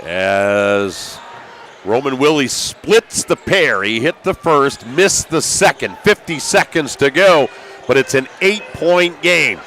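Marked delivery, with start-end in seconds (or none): none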